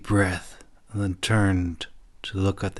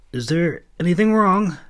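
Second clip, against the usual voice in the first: hesitant question; 9 semitones higher, 4 dB louder than usual. A narrator's reading. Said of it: "hesitantly leaned forward and asked".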